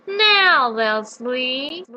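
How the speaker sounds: very slow, drawn-out incantation; spell incantation. Acted. Witch casting sleep spell, slow drawn incantation. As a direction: draw out the spell slowly.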